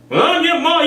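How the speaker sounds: high, put-on claiming voice; high mock voice claiming to be mom; mock stranger. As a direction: pitch it up as a pushy stranger. Mocking voice of a stranger.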